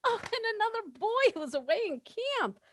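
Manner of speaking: high, breathless, tumbling rush; a flustered girl who can't think straight; flustered nervous. Acted. Muriel, nervous meeting her old flame, high and breathless. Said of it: "I can't catch my breath".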